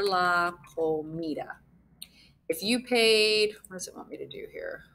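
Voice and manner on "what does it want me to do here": muttered aside. Real, not acted. Muttering to herself at the board.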